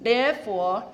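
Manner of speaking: ordinary talk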